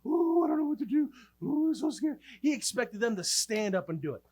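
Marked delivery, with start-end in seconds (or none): 0.0-2.2 high, panicky mock whine; mock complaining. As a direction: act out helpless, scared complaining in a raised pitch. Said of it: "run around and complain".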